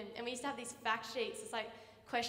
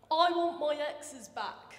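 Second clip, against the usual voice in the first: put-on English accent teacher; 3 semitones higher, 8 dB louder, much slower than usual. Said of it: "thick English accent".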